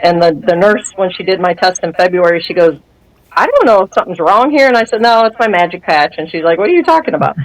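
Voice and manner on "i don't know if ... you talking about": usual speaking voice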